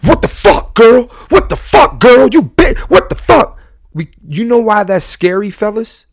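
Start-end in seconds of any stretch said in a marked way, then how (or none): 0.0-3.6 high-pitched mock yelling, in bursts; imitated angry yelling. Host showing ladies how guys yell at them, high mock yell. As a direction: act out a man yelling abuse at his girlfriend. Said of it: "you've had guys yell at you".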